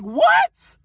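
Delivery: shocked, loud, high-pitched what; outraged squawk at the unfair rule; indignant what. Retold or imitated. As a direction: bark it out in disbelief. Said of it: "I'm like".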